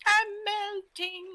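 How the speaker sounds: high, drawn-out theatrical wail; high-pitched mock death cry; mock melting wail. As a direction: wail it slowly as a hammed-up dying villain. Mock Wicked Witch death wail.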